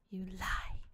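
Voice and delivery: hissed under breath; whispered accusation. Real, not acted. Accusing the present.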